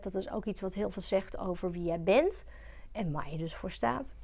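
usual speaking voice

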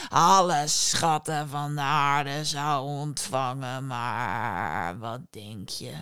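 low, drawn-out genie telling his tale; djinn voice. A narrator's reading. Djinn tells his story. (lage, trage djinnstem)

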